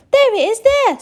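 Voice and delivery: high loud pointing shout. Acted. Someone in the crowd points out the ticket, shouting high. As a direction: yell it while pointing. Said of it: "someone else shouted".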